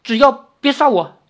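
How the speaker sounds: high, loud, halting plea; frightened old man. A narrator's reading. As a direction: beg for your life in a high voice. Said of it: "那老头结结巴巴地说". (惊慌地高声求饶)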